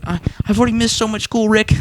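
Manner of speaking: high whiny stammering Morty; mock whiny teen Morty. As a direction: whine it up high. Retelling Morty's voice.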